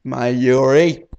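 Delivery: loud call across the room. Acted. Okabe calling Mayuri back, loud. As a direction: call her back sharply.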